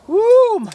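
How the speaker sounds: loud, high "woo"; winded whoop. Real, not acted. Archer feeling the burn after a sprint, loud whoop. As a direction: let out a high whoop of exertion.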